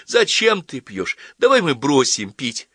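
high, slow, drawn-out plea; tearful pleading. Acted. Daughter begs father to stop drinking, tearful pleading. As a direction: plead like a child near tears, lightly acted. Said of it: "заплакала Ася".

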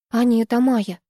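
ordinary delivery

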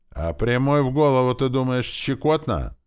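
high, loud rhetorical jab; angry reproach. A narrator's reading. Orc to boy who once punched him, angry reproach. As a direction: throw the grievance back at him. Attacker angrily accuses the boy.

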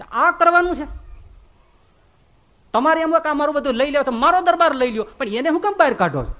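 high, loud scolding rebuke; indignant scolding. Retold or imitated. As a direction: tear into him for throwing the saint out. Saint angrily scolds the master.

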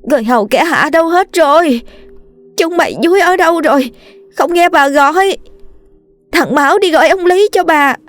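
high, desperate calling out in pain; screaming in labor pain. A narrator's reading. Mistress in labor screaming for her husband, high and desperate. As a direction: cry out for help through labor pains. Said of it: "Bà gọi khản cả cổ".

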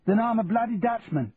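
a London cockney grumbling at the snow; Cockney recruit moaning about the Scottish cold; Cockney accent. Acted. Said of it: "Charlie heard a Cockney accent declare".

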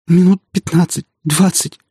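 high-pitched tearful answer; sobbing. A narrator's reading. Distraught woman answering her friend, sobbing. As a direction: whimper the reply through sobs. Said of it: "всхлипывая, ответила Вика".

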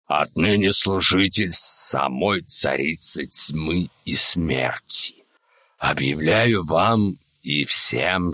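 very low, slow, hollow undead voice; hollow otherworldly voice. A narrator's reading. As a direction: speak like a dead man from beyond the grave. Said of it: "заговорил глухим, потусторонним голосом".

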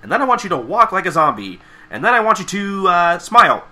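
hammed-up eager instructions; Pinky. Pinky coaching the ponies to camouflage, high bossy mock-instructor. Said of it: "she just tells the ponies how".

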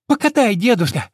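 put-on high, loud pleading kid; begging child. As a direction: beg grandpa eagerly for a ride. Child begs grandpa.

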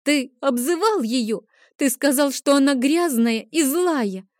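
put-on high little girl voice, near tears; tearful accusation. A narrator's reading. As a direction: accuse your brother in a high, hurt voice. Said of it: "обвинила его сестра со слезами на глазах".

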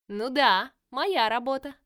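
loud, gleeful taunting villain voice; mock the heroes with cheerful menace; playful villainess. Villain Virus speaks.

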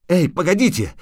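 high, urgent call to wait. A narrator's reading. Bard calling after the riders, urgent.

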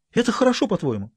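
put-on girl's voice. A narrator's reading. Girl teasing the governor, lightly high and mocking. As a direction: tease him like a schoolgirl.

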